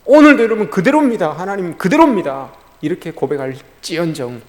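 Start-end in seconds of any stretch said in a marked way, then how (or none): 0.1-2.5 loud, raised plea; crying out in confession. Acted. Believer confessing before God that nothing changed, loud raised cry. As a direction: cry it out as a prayerful complaint.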